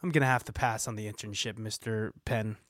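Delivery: low, soft, muttered backing out; subdued, low-voiced polite exit; quiet refusal. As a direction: back out quietly, voice dropped. Let-down applicant declines the internship.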